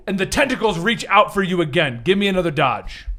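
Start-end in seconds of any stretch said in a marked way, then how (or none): none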